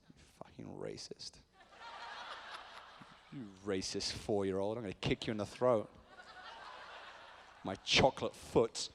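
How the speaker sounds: low, quiet muttered threat; mock menace. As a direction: mutter a pretend threat under the breath. Mock threat to a child, under breath.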